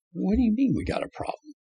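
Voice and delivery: a high, stunned what do you mean; retelling his shocked reply to the official, high and incredulous; alarmed disbelief. Retold or imitated.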